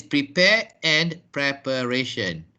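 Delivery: "prepare" as pri-PAIR, "preparation" as prep-uh-RAY-shun; long-vowel "pre" in "prepare", short "prep" in "preparation"; correct pronunciation. Shown as a demonstration. Teacher confirming a student's answer, clear correct pronunciation. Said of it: "So it is".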